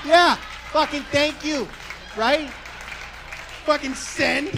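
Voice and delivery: high, loud, slowed boast; smug triumph. Real, not acted. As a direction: crow over the joke like you nailed it. Triumphant about his own tweet.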